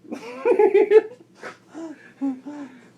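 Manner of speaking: loud, high burst of laughter; high cackling laugh; real laughing fit. Real, not acted. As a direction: crack up helplessly mid-story. Storyteller cracks up.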